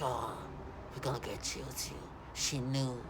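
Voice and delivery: a light sneering imitation, hushed; mocking their voices. Mocking the voices the harassers make, a hushed sneer. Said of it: "the humiliating voices they make".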